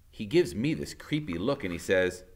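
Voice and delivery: ordinary delivery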